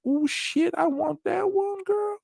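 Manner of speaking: high, slow longing whine; mock smitten guy. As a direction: swoon over the girl, way up high. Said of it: "other guys would be like".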